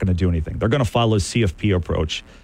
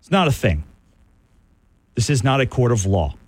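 ordinary talk

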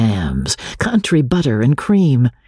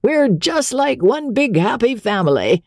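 put-on high, bright-sounding old showman, much higher pitched; Captain Andy's voice; 11 semitones higher than usual. Captain Andy boasting to his troupe, high squeaky voice. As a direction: squeak it out like a fussy little captain. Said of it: "was fond of saying".

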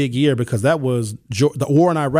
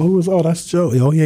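ordinary talk, nothing marked

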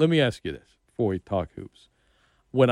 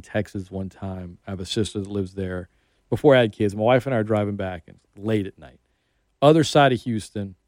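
usual speaking voice

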